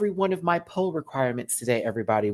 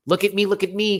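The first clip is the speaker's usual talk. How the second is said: attention-seeking mock; 7 semitones higher, 5 dB louder than usual. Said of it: "kind of energy".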